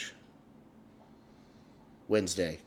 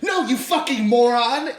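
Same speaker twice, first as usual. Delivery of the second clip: high, loud mock yell; exasperated outburst; 12 semitones higher, 7 dB louder than usual. Brain yelling at person failing a puzzle reel, exasperated mock shout. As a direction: act out an exasperated inner scream. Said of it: "My brain is like".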